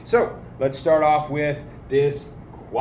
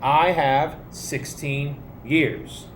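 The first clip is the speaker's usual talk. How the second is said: slow teaching pace; slower than usual. Shown as a demonstration. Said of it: "your answer is saying".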